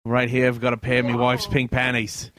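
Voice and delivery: hammed-up Aussie doctor; Australian accent. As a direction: narrate like a mischievous Australian surgeon. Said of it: "Oh, Australian, my bad".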